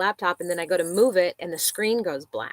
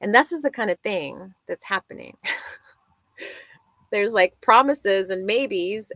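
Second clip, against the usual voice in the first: ordinary delivery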